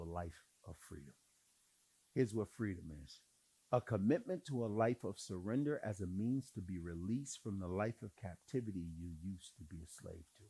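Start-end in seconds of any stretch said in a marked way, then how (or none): 3.7-10.4 low, soft statement of the definition; said low and gentle before repeating it; quiet first take. Shown as a demonstration. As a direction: lay it down softly. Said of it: "repeated for emphasis".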